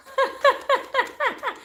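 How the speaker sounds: high, bright giggling laugh; burst of laughter. Real, not acted. Laughing at chat saying she sounds Southern, high giggle. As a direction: crack up laughing at the comment.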